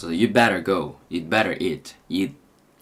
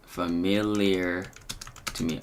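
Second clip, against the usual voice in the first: ordinary talk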